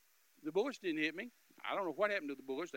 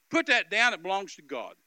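stern command; 5 semitones higher, 14 dB louder than usual. Retold or imitated. Commanding robber in the car.

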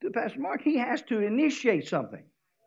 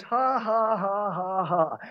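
mocking laugh; slower than usual. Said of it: "the fig tree says".

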